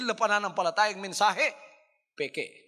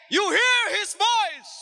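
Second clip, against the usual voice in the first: higher, louder, slow shouted repeat; full-force preacher's shout of the same line; preaching shout; 14 semitones higher, 8 dB louder, slower than usual. Shown as a demonstration. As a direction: bring it home with a shout.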